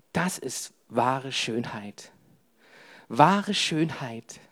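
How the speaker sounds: hushed, slowed-down sincerity; soft and slow. Acted. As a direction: let it sink in softly. Soft, slow emphasis after story.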